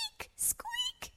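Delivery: very high, tiny squeal; frightened squeal. Acted. Teacher on a chair fleeing loose gerbils, frightened squeal. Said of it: "she cried".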